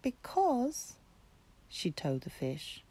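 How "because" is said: eager kitten. Acted. Kitten tells the fish.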